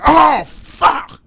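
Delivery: high, acted cry of pain; mock stubbed-toe outburst; pained curse. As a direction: curse like you just stubbed your toe. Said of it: "Like when you hit that toe".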